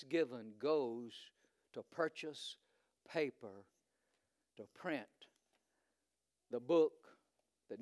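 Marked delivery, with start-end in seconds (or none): none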